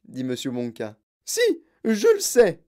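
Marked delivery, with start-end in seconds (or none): 1.2-2.6 high, loud contradiction; roaring. A narrator's reading. Mother contradicts Wonka, roaring. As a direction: roar it back at him. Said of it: "rugit Madame Gloop".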